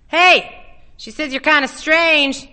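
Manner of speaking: loud, high call across the yard. A narrator's reading. Arnold shouts to Ellie across the yard, loud and high. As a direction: shout it over to him, mocking.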